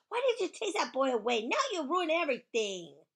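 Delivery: high, loud accusation; upset, high-pitched yelling; angry hollering. Acted. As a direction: holler it like they spoiled it all. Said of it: "she hollered".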